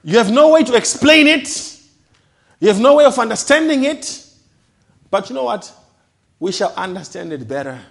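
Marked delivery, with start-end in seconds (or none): 0.0-5.7 loud, high pulpit cry; fervent preacher's shout; full-force preaching. Acted. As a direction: proclaim it over the church.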